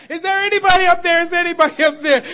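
loud, high, fast desperate call; crying out. Retold or imitated. Man hanging off a cliff calling for help, loud desperate cry. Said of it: "he's crying out".

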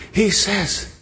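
usual speaking voice

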